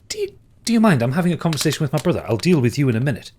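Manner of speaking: high, fast irritated brush-off; irritated snap. Acted. Cassandra snapping at the stranger, high, fast and irritated. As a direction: cut the intruder off sharply, rushing it.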